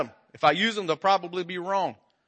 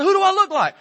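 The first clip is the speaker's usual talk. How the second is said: baffled husband; 13 semitones higher, 6 dB louder than usual. Retold or imitated. Husband's retort in quarrel.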